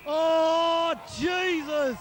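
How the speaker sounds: loud, startled cry of "Oh, Jesus"; shocked shout. Real, not acted. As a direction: burst out in disbelief. Shock at play.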